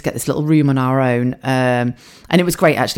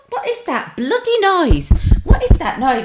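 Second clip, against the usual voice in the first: much higher, bossy mum voice; mum in hospital. Retold or imitated. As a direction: nag like a ward sister mum.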